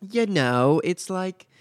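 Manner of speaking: badly faked British accent; horrible British accent. Shown as a demonstration. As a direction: try a British girl's voice and botch it. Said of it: "I'm not even gonna try to do it because mine's horrible".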